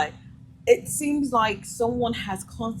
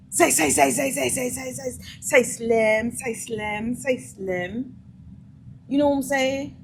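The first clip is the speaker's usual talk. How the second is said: pestering pickup guy; 4 semitones higher than usual. Retold or imitated. Ugly guy stepping up to any woman, pestering. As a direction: imitate a man insistently hailing a woman.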